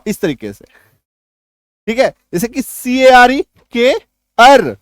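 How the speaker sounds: normal talking voice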